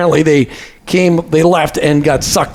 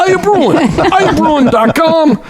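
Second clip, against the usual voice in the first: put-on very slow, high, laughing Jersey accent; 11 semitones higher, much slower than usual. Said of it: "your Jersey accent".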